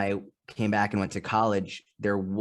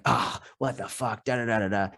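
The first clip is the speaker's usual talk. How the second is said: mock exasperation. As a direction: act out being mildly mad at a partner. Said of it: "you're just mad about it".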